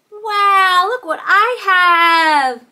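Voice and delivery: loud, slow, high singsong reveal; amazed delight. Pretend. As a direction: show off a treasure to small children. Reveals a toy guitar.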